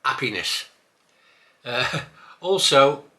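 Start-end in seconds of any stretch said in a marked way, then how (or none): 0.0-0.7 Happiness said as 'appiness, no H; dropped H. Shown as a demonstration. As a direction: drop the H off Happiness. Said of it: "quite acceptable to drop your H's".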